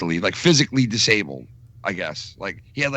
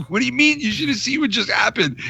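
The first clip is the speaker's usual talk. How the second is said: incredulous; 10 semitones higher than usual. Retold or imitated. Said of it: "And I'm like".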